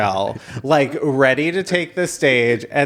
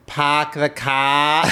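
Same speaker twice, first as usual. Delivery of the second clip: put-on slow, drawn-out Boston accent; botched New Jersey accent; much slower than usual. Showing off to coworkers with a New Jersey accent that comes out Boston. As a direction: attempt New Jersey, land in overdone Boston. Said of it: "perfect execution of a Boston accent".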